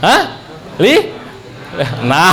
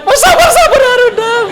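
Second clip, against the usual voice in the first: very high, squealing mock plea through laughter; a laughing high-pitched whine; 4 dB louder than usual. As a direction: squeal it out while cracking up. Nurse vs. patient mock exchange.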